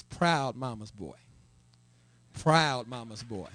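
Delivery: proud said slow and leaned on; proud mama's boy, slow, with proud stretched and stressed. Shown as a demonstration. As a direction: linger on proud, slow and weighty, both times. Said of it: "and I emphasize".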